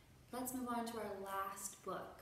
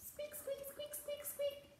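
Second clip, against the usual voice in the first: very high, soft squeaky mouse; mouse squeaks; 5 dB quieter than usual. Pretend. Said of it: "don't forget to squeak".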